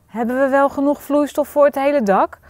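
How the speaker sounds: mildly uneasy query; slightly anxious. A narrator's reading. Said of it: "een beetje benauwd".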